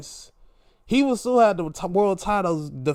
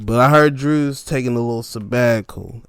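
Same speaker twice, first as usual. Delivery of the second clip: ordinary delivery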